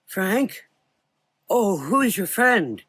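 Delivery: put-on high, frail old doctor. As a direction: greet them with blind curiosity.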